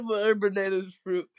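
laughing mimicry. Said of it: "He said".

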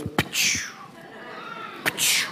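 whispered hissing "Pachoo" spray sound; air freshener spray noise. Retold or imitated. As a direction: spit out a short spray hiss. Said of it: "It goes".

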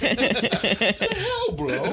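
slow, high, laughing what the hell; laughing disbelief. Retold or imitated. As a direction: crack up mid question. Son's imagined reaction, laughing.

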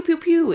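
high little pew noises; imitated finger-gun shots. Showing how Cuphead finger guns sound, high pews. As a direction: fire off toy-gun pews. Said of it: "they go pew".

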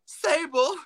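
high, pleading call; a hint of a timid announcer; mock plea. Imitating the announcer calling to Sable, timid plea. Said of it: "comes up with a microphone like".

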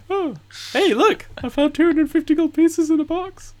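high, falsely surprised voice; fake innocent. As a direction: pretend you just found it. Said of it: "and be like".

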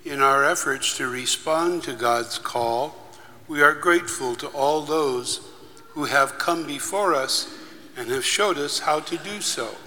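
prayer. Acted. Leads a liturgical prayer.

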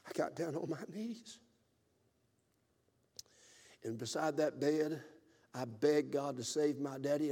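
soft, slow pleading prayer fading to a whisper; soft begging prayer, ending whispered; hushed prayer. Retold or imitated. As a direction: pray it quietly on your knees. Praying beside bed for father.

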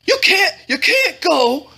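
high, urgent interruption. A narrator's reading. Cousin stops her over her name, fretful.

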